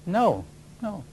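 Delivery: ordinary talk, nothing marked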